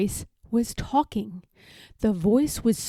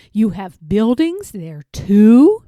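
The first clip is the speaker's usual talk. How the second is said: louder, astonished question; gasping surprise; 8 dB louder than usual. A narrator's reading. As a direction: gasp it in wonder. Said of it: "Horton gasped".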